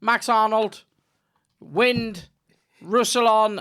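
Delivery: high, loud commentator reading out the lineup; mock commentator. Imitating the TV commentator for his friend, loud hammed-up call. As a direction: reel off names like a giddy pundit. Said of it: "he'd be like".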